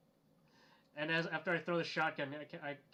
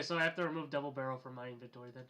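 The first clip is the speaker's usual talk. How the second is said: ordinary talk, nothing marked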